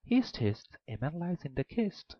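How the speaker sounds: high eerie calling voice; a high sing-song call. A narrator's reading. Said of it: "called out and said".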